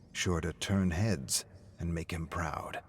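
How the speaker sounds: usual speaking voice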